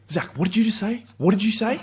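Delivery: high, loud, outraged teacher voice; shocked teacher shouting. Retold or imitated. Teacher catching a swearing student, shrill outraged shout. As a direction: squawk it out like a scandalised teacher. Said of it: "And she's like".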